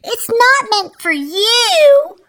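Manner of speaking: high, loud, drawn-out stepsister protest; indignant cry. A narrator's reading. Stepsister snatching the invitation from Cinderella, indignant cry. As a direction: cry out in a high, bratty wail. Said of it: "cried Anastasia".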